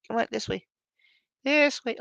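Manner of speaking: high, hushed pet voice; coaxing the cat. Real, not acted. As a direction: lure the cat gently. Guiding cat through gap.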